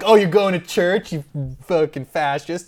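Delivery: high, loud mocking sneer; mock him like a contemptuous punk. Punks sneering at the old man going to church, loud mocking sneer.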